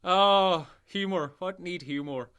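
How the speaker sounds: ordinary delivery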